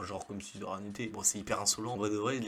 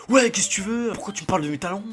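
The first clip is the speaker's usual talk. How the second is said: higher, louder furious shout at him; angry yelling woman; 8 semitones higher, 11 dB louder, faster than usual. Retold or imitated. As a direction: tell off the stranger who mocked you. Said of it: "qui commence à m'engueuler".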